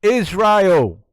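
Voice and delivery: loud, high, falling exclamation; emphatic shout. Real, not acted. Commentator answering own question for viewers, emphatic shout. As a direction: belt out the one-word answer.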